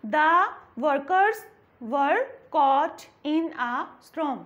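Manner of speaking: slow, clear sentence with workers said with rounded lips; slow model reading. Shown as a demonstration. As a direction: model it slowly for students to copy. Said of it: "जैसे the". (धीमा, साफ़ आदर्श उच्चारण)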